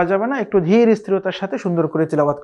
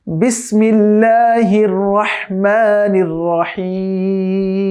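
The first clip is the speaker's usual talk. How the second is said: drawn-out melodic tajweed chant; slow Quran recitation; much slower than usual. Shown as a demonstration. Teacher recites slowly for students to copy. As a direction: recite each syllable long and measured.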